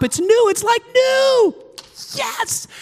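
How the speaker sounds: thrilled shout. Real, not acted. Pastor telling church his car is finally fixed, high excited shout. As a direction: shout the joy of getting it back.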